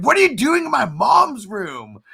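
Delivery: put-on high, loud outraged girl; high girl's shout. Retelling a movie scene to cohost, high outraged girl's shout. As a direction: shriek it like she caught intruders. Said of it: "the girl... comes in".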